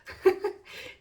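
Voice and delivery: breathy short laugh; light laugh. Real, not acted. Tarot reader laughing at her own bit, light laugh.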